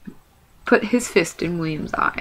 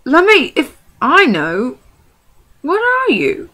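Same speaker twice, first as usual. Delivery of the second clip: high, loud, slow troll question; puzzled troll; 9 semitones higher, 6 dB louder, slower than usual. Acted. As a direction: peer at the odd creature and demand an answer.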